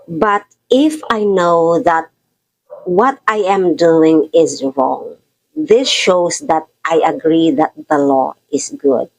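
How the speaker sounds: slow, deliberate Bible reading; scripture reading. Acted. Preacher reads the verse to the congregation, slow scripture reading. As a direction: read each phrase slowly to let it land.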